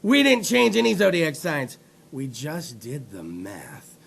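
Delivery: low, soft, condescending mock statement; smug, hushed correction, imitated; dickish NASA statement. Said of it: "nasa was a little bit of a dick about it".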